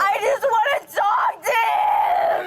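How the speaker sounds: sobbing, slow, through laughs; crying. Real, not acted. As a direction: break down crying.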